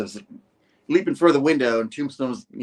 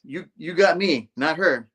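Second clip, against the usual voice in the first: defiant shout; 6 semitones higher, 5 dB louder than usual. Acted. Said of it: "Spidey's like".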